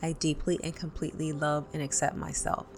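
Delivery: calm, paced guided tapping with pauses between points; guided tapping script. Acted. Tapping coach to viewers, calm guided script. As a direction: lead the viewer through tapping points and phrases to repeat.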